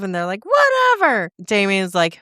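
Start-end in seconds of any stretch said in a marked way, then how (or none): 0.4-1.3 dismissive. Retold or imitated. Captors dismissing his excuse.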